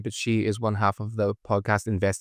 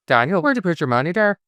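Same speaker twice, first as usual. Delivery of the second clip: hammed-up bad Irish accent; louder, higher mock-Irish brogue; Angel; 5 semitones higher, 5 dB louder than usual. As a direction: do a badly faked Irish accent. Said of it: "That's what Angel was sounding like".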